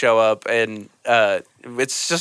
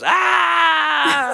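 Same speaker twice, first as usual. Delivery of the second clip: high shouted crowd "Ah!"; a whole room erupting in a high cheer. Retold or imitated.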